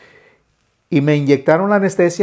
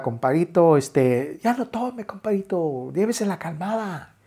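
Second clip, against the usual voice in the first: gentle advice; 3 semitones higher, 7 dB quieter than usual. Retold or imitated. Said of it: "y me decía".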